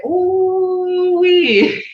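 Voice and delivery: drawn-out delighted ooh-wee to a grandchild; grandma's ooh-wee. Retold or imitated. Grandmother admiring what grandkids show her, delighted. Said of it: "I'll say".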